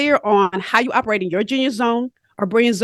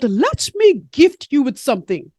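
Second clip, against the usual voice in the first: hammed-up Caribbean mentor voice; badly faked Caribbean lilt; bad Caribbean accent; 3 semitones higher than usual. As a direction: imitate a Caribbean mentor offering wisdom. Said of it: "about to mess up her Caribbean accent".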